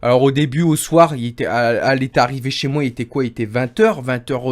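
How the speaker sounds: usual speaking voice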